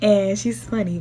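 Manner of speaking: ordinary delivery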